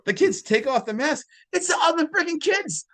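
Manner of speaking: high-pitched, indignant exclamation; worked-up outburst. Real, not acted. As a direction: blurt the twist out in high-pitched outrage. Outraged at the film's prank.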